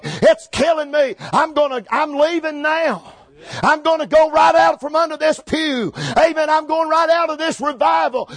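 high, insistent preaching cry; urgent, overwhelmed revival plea; impassioned plea to God. Retold or imitated. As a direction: retell a desperate prayer at full pitch. Retold overwhelmed plea to the Lord.

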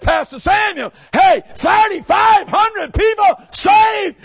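excited exclamation. Retold or imitated. Retelling his excited exclamation to pastor.

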